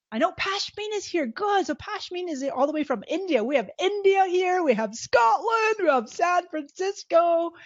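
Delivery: high-pitched, thrilled host roll call; excited roll call. Real, not acted. Host welcoming guests from around the world, excited. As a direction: call out each far-off place with rising excitement. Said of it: "How exciting is this?".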